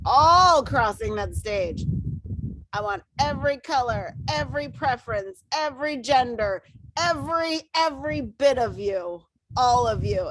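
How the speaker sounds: rallying cry, pitched high; impassioned. Real, not acted. Leader wants her whole team on stage, impassioned. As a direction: punch each "every" with rising passion.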